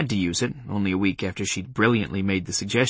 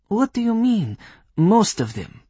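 put-on Polish accent; 11 semitones higher than usual. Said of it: "in a Polish accent".

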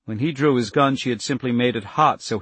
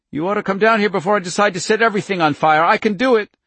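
high, loud, fast angry shouting; furious threat; 9 semitones higher, 6 dB louder, faster than usual. A narrator's reading. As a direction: threaten him, shaking with rage. Furious child threatening fire.

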